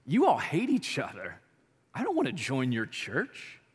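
low, hushed, drawn-out disbelief; outsider scoffing at the church. Retold or imitated. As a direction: sound like a baffled outsider shaking their head. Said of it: "the world looks at the church sometimes and go".

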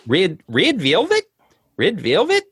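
high, questioning lilt in a badly faked accent; hammed-up foreign accent, each phrase rising; Thomas's accent. Shown as a demonstration. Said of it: "that's not Swedish".

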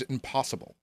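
usual speaking voice

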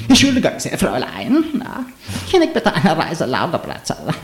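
put-on high, swooping German accent; anime-style German. Playfully showing friends own German, high hammed-up anime-style German accent. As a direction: ham up German like an anime character. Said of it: "Like someone in anime speaking German".

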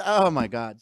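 ordinary talk, nothing marked

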